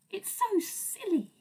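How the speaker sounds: usual speaking voice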